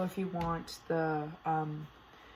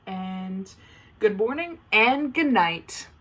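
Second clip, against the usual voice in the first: slow, drawn-out sign-off, rising at the end; slow playful farewell; singsong sign-off; 5 dB louder, much slower than usual. Acted. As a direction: stretch out the catchphrase. Signature sign-off.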